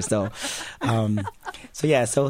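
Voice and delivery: normal talking voice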